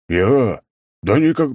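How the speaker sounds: loud flustered, high protest; flustered excuse. A narrator's reading. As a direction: stammer out an excuse. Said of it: "начал было оправдываться ошалевший воин".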